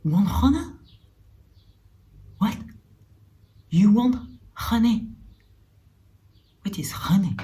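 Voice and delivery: put-on halting English with a light Russian accent; mock Russian accent. Retelling the Russian au pair offering honey, Russian-accented English. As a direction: repeat honey with a Russian lilt, puzzled. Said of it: "elle me fait".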